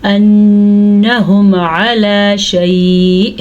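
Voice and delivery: अन्नहुम with a long nasal nun, slow; ghunna. Shown as a demonstration. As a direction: hold the nun in the nose. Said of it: "नून के ऊपर शद आ गई तो हमने गुन्ना किया". (अन्नहुम में गुन्ना, नाक से)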